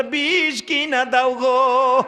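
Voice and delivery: high, rising wail of unbearable pain; desperate plea. Acted. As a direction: beg in despair, building to a cry. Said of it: "সহ্য করতে না পাইরা ডাকে".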